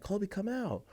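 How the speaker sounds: high, soft mock call; high-pitched call, held back in volume; retold calling out. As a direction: imitate coaxing a dog out. Calling hidden dog again.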